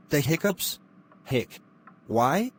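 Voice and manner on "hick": hiccup. Acted. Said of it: "I think you have the hiccups".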